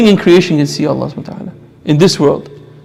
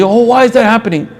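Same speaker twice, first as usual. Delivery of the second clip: higher-pitched mock lament; mock-bewildered "oh, why" wail; surface-level complaint; 5 semitones higher than usual. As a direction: imitate someone unthinkingly bemoaning events. People reacting without thinking.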